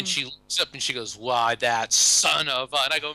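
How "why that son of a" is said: slow, louder mock outrage; indignant old lady. Widow hears husband's joke answer, mock indignant granny. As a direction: sputter like an offended granny.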